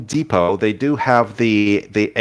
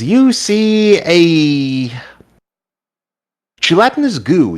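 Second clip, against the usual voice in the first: dramatic reveal; 6 semitones higher, 4 dB louder, slower than usual. Acted. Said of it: "Yes, a gelatinous goo".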